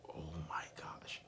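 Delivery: breathless whispered "Oh my gosh", almost no voice; shocked whisper. Retold or imitated. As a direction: whisper it like a kid caught talking. Kids shocked hearing him speak Russian.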